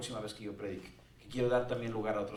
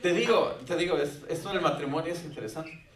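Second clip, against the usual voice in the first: playful exclaim; 4 semitones higher, 6 dB louder than usual. Real, not acted. Joking aside about marriage.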